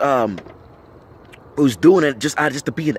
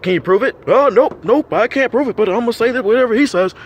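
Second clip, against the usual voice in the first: mocking followers; 7 semitones higher, 4 dB louder than usual. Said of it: "and say".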